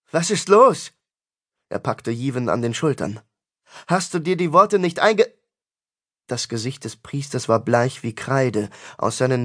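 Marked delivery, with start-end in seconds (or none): 0.1-0.9 high, loud, alarmed question; frantic demand. A narrator's reading. Tristan grabs the priest by the shoulders, frantic. 3.9-5.3 high, agitated demand, cut off; frantic questioning. A narrator's reading. Tristan questions the bleeding priest, frantic.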